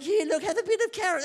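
high, hammed-up coaxing; coaxing parent. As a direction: imitate a parent pleading over dinner. Voices parent coaxing a child.

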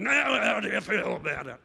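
garbled, juddering voice as if shaken; shaken about. Acted. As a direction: jabber it like you're being rattled in a tin. Imitates shaking up a tin.

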